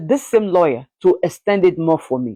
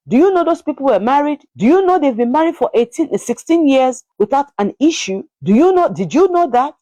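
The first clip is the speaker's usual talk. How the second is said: high-pitched, insistent retold questioning; incredulous disclosure; 9 semitones higher than usual. Friend revealing the lawyer's secret marriage, high insistent disbelief. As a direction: press each question harder, pitched well up.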